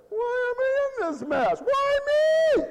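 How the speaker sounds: high, rising, whiny cry ending in a shout; whining complaint. Retold or imitated. Preacher mocks a believer crying out to God. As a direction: wail it like a self-pitying victim.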